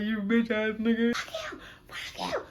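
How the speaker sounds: loud furious cursing, pitch leaping; going off. Retold or imitated. Woman cursing out her cheating boyfriend on phone. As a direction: cuss him out on the phone. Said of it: "Going off".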